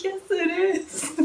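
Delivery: high, slow, laughing through it; laughing rebuttal. Retold or imitated. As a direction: laugh it out, half amused, half defeated.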